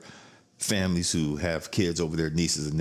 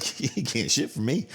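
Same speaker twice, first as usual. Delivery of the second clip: laughing; 12 semitones higher than usual. Retold or imitated. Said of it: "would just look at me like".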